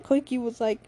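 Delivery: ordinary delivery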